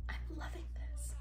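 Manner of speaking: breathy whispered delight. Real, not acted. Vlogger whispering to camera over her dinner. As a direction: whisper it while savoring the bite. Savoring food, whispered aside.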